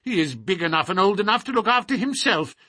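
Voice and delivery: put-on high, testy old man; quick, indignant old man's rebuff; querulous old lord, dismissive. A narrator's reading. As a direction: refuse flatly in a crotchety old voice.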